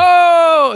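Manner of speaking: a sudden high, loud "Oh"; high mock gasp. Coworker mimics [name] mocking his lunch, high yelp. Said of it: "like, do the".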